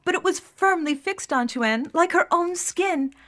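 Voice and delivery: plain reading voice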